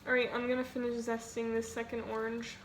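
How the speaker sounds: ordinary delivery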